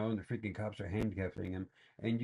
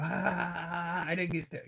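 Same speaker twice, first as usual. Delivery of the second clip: higher, sheepish mock confession; a hammed-up faker admitting the lie; mocking a fake victim; 9 semitones higher, slower than usual. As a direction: own up like a caught faker. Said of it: "you know".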